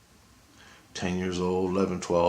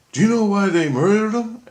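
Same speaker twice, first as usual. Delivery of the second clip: much higher and louder, a booming demand; yelling voice in head; 13 semitones higher, 8 dB louder than usual. Retold or imitated. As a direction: shout it as the voice in his head. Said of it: "Christ is yelling in my head".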